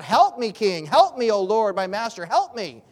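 a starving woman begs the king for help; crying out for help. Acted. Woman begging the king, cried out loud. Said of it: "the woman cries out".

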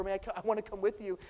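the healed man begging Jesus, fast and eager. Retold or imitated. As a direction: beg to come along. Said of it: "begging over and over".

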